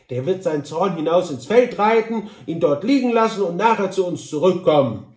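confident Tante Droll. Acted. Droll advises the Kantor about Frank, emphatic dialect voice. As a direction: explain your cousin's quirks with assurance.

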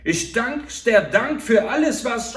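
loud and high, indignant; stabbed man yelling his last protest; outraged shout. A narrator's reading. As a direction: hurl the reproach at them. Victim confronting his murderers.

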